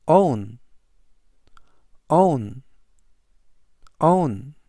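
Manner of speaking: "own" said as one round vowel, ending falling; clear English demo. Shown as a demonstration. Teacher models "own" for Korean learners. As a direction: model "own" cleanly three times. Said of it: "이라고 발음하시면 되겠고요".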